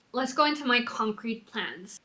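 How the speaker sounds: normal talking voice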